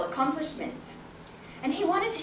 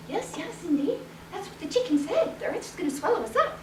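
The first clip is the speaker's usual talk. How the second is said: softer, quick confiding; hushed agreement; 3 semitones higher, 6 dB quieter, faster than usual. Acted. Wife helping her fallen husband, hushed agreement. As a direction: murmur it to your husband. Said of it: "And his wife".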